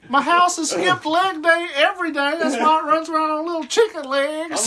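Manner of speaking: squeaky mock Baba Yaga greeting the room; hammed-up witch introducing herself; witch girl voice.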